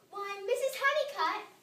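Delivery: high, loud shout of surprise. A narrator's reading. Missus McSnoot shouting at her neighbor, loud and high. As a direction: bellow her name across the street. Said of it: "shouted Missus McSnoot".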